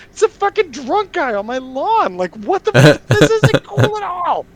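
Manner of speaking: high, loud furious rant; exasperated shout. Retold or imitated. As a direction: yell it at the gawking neighbors. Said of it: "I turn to all the old neighbors and go".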